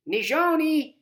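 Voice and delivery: high, loud call, insistent; grandma calling the girl again, insistent. A narrator's reading. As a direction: call her name a second time, firmer. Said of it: "Grandma says again".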